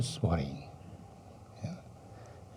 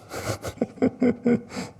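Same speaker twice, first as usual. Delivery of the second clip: high, breathy chuckling laugh; a run of quick chuckles at your own irony; 12 semitones higher than usual. Real, not acted.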